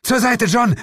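high, loud shout; bellowed alarm; urgent warning yell. A narrator's reading. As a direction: yell after him to clear the way. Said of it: "brüllte mein Partner hinter mir her".

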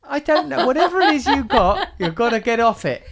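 high, laughing tease. Real, not acted. Host laughing as he teases his cohost. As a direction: laugh through the teasing.

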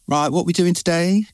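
high, bright generic hairdresser chirp. Retold or imitated. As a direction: put on the cheery stylist greeting. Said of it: "Everyone always does that voice".

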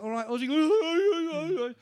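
high, loud, slow drawn-out greeting; hammed-up cheery hello; mock greeting. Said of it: "I'm like".

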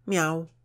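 high, loud imitated meow; cat's meow. A narrator's reading. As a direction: mew like a cat. Character imitates a cat for pupil.

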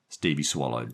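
ordinary delivery, nothing marked

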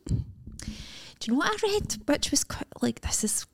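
soft, slow secretive whisper; whispered gossip. Real, not acted. Friend to co-host, a slow secretive whisper.

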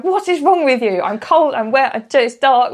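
high, loud exasperated complaint; exasperated outburst. Retold or imitated. Cold, wet girlfriend snapping at James in rain, exasperated. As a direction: let it burst out, fed up and cold.

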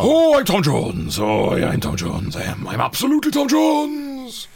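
big, drawn-out singer impression. Mocking name-announcing impressions, hammed-up famous singer. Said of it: "without you saying who you are".

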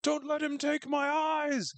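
high, slow, shrill frightened cry; terrified plea. Retold or imitated. Grandma sees the man with no eyes, high terrified pleading. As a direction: beg in panic, voice high and strained. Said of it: "The old lady's freaking out".

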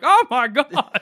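high, delighted gasp of joy. Retold or imitated. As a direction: squeal it with delight. Reacting to dog in goggles.

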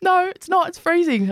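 high, put-on indignant voice; mock his protest up high; imitating friend.

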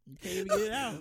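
high, laughing repeat; laughing shout. Real, not acted. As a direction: shout it while cracking up.

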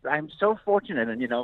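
normal talking voice

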